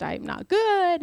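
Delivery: high, drawn-out mock whine; mock self-pity. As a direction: stretch it high, sulking in jest. Mimics her own self-pitying spiral.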